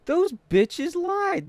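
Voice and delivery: high, slow indignant whine; mock outrage. Real, not acted. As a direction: stretch it out high and betrayed. Outraged again, still higher.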